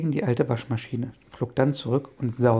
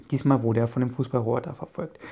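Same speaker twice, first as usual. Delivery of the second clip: usual speaking voice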